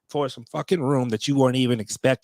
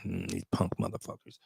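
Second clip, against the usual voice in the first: whispered curse; 11 dB quieter than usual. Real, not acted. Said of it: "I'm getting my emotions".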